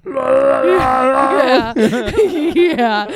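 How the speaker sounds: helpless loud laughter; laughing hard. Real, not acted.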